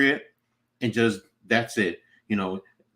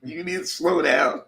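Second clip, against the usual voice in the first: higher voice straight out of laughter; laughing tease; 10 semitones higher than usual. Pretend. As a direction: tease him through the laughs. Laughs while teasing the speeder.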